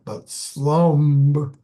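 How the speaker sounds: SLUMB hit loud and long; strong stress. Shown as a demonstration. Teacher showing the class a stressed syllable, loud and punched. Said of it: "has a strong stress".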